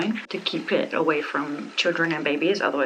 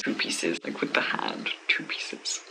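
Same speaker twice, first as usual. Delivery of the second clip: whisper; 12 semitones lower, 6 dB quieter than usual. Pretend. Mock whisper aside about two pieces.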